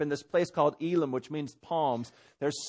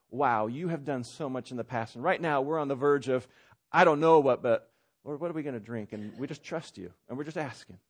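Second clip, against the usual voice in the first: trusting prayer; 4 semitones lower than usual. Acted. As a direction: ask gently, leaning on past help. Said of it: "there's another that says".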